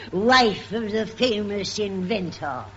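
normal talking voice

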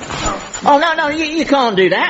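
high, stammering plea; alarmed protest. Acted. Companion pleading against an arrest, alarmed and stammering.

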